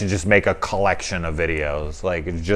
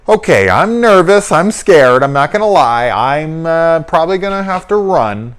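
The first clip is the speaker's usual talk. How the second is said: high, loud, panicky imagined line; nervous fear; 8 semitones higher, 5 dB louder than usual. Retold or imitated. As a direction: play the scared girl admitting she'll run. Imagined scared line for heroine.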